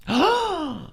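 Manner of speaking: surprised gasp. Acted. Finding a hidden safe.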